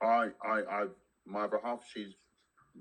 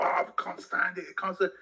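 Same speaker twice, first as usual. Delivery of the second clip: imitating a moaning Londoner, higher; whiny put-on moan, pitched up; mock complaint; 7 semitones higher than usual. Mocking people who can't stand London, in a higher whine. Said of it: "if you talk to some people".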